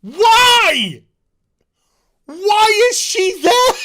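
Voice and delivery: high, loud, slow disbelief; incredulous outburst. Real, not acted. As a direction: demand an answer from the writers. Ranting about a plot hole.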